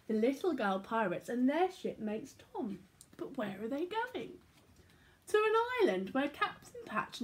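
put-on lively read-aloud for children; animated storybook reading; story narration. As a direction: tell it like a bedtime picture book. Reads picture book aloud.

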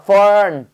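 Fern said with a clear r sound after the vowel; Fern, make sure the r is heard. Shown as a demonstration. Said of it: "알이 들어가야 돼요".

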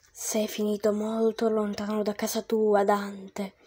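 low, soft otherworldly voice; gentle ghost Virgil. Acted. Virgil calming lost Dante, low and soft. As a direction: speak softly from beyond. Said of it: "Virgilio mi sorrise e disse".

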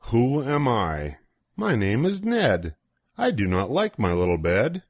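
ordinary delivery, nothing marked

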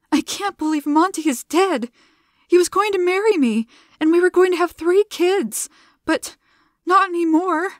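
high, loud grief-stricken pleading; grieving. A narrator's reading. Zilla telling Gianna her dead fiancé's dreams, grieving. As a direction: let the loss spill out.

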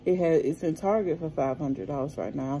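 usual speaking voice

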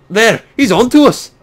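hammed-up pirate accent; indignant pirate. Pirate confronting the stranger who dodged barrels, hammy pirate voice. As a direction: challenge them as a mock-outraged pirate.

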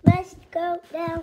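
slow robot announcement. Acted. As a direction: drone each word out separately. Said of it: "I am a robot".

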